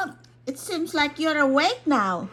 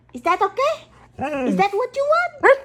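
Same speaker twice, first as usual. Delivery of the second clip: high excited pet voice; 6 semitones higher, 5 dB louder than usual. Real, not acted. Asking dog excitedly.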